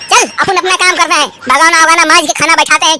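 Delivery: usual speaking voice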